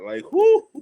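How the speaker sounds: loud, high whoop of relief; relieved whoo. Real, not acted. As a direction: let the relief burst out. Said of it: "'cause we was nervous".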